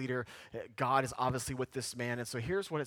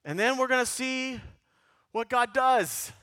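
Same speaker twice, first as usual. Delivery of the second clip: mock reassuring Gideon; 10 semitones higher, 7 dB louder than usual. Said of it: "and he says".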